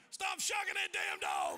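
loud, high, slow furious shout; angry yell. Retold or imitated. Friend running from the dog, yelling at him to stop.

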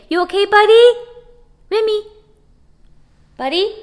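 high, loud caring pet call; calling the dog. Real, not acted. Owner asking dog Remy if he's okay, high and loud. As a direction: check on him, high and sweet.

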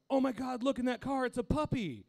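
high, loud mock squeal of delight; hammed-up excitement, high and loud; imitating excited wife. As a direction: gush like you just spotted a puppy. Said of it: "Why you gotta fucking say it like that".

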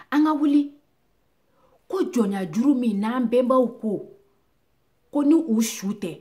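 loud, high, forceful oratory; rallying cry. Acted. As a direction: ring out the call to rise. Rallying call in recited poem.

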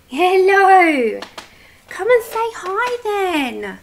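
high, drawn-out cooing to a dog; sing-song pet greeting; puppy talk. Real, not acted. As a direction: coax the puppy over in a high, stretched voice. Coaxing the puppy over.

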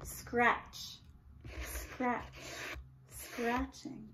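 soft, breathy scratch sounds; faint scratching. A narrator's reading. Narrator mimicking faint scratching under floor, soft. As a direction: let it barely reach the ear. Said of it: "She could hear a faint".

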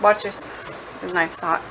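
usual speaking voice

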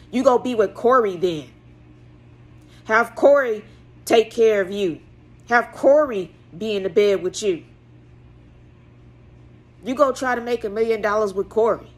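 loud, accusing mock yell at his wife; jealous husband yelling. Husband yelling at wife over Corey, loud jealous accusation. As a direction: throw it at her like a jealous accusation. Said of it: "He was like".

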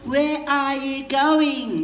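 slow, every word given full weight; slow wordy version. Retold or imitated. Mocking the wordy way, slow and drawn out. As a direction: stretch it out politely. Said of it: "you people will say something like".

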